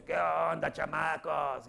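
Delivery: brash, confident clown greeting; cocky showman hollering at kids; Broso voice. Retold or imitated. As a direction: swagger in like Broso. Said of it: "y se pone lo de Broso".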